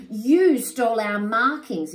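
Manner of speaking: loud, high accusing yell. Acted. Animals yelling at the warthogs, loud angry yell. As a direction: shout the theft at them. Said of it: "yell the other animals".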